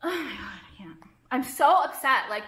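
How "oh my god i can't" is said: hushed, drained oh-my-God; exasperated whisper. Real, not acted. Creator upset over lost followers, exasperated whisper. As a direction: whisper it, too upset to speak up. Overcome while venting about followers.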